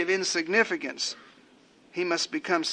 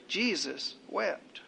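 very soft and slow, each word set apart; hushed poem ending; 10 dB quieter, much slower than usual. Acted. Preacher ends the poem on tears, slow and hushed. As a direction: let the last two words fall into a slow hush. Last line of recited poem.

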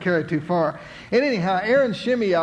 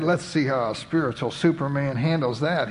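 usual speaking voice

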